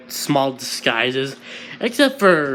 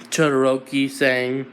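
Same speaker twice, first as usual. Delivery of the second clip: usual speaking voice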